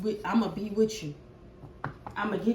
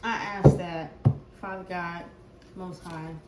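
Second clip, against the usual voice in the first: low, soft prayer voice, quicker on the long lines; 6 semitones lower, 4 dB quieter than usual. Acted. Reader praying softly over the collective before the reading. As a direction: pray quietly to God and the ancestors for the collective. Prays for the viewers.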